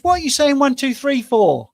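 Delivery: high, loud incredulous wife; wife's shrill-free but high, loud protest; indignant outburst. Acted. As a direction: blurt it out in disbelief.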